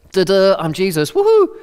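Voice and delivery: hammed-up, loud, high "woohoo"; mock triumphant Jesus. Jesus mock-celebrating himself, loud and high. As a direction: cheer like a showy party trick. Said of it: "he doesn't go".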